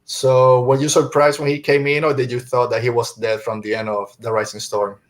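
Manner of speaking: usual speaking voice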